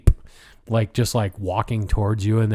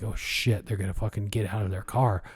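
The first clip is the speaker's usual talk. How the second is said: softer, quick worried murmur; hushed; 2 semitones lower, 8 dB quieter than usual. Retold or imitated. Hiding friends fear the driver comes out, hushed and quick. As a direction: say it fast under your breath.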